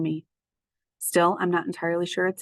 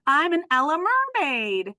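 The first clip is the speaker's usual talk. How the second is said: put-on high, slower child voice; proud little kid playing; playful child; 9 semitones higher than usual. Ruby showing off to Bob, playful child voice.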